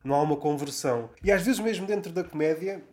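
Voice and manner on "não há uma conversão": ordinary delivery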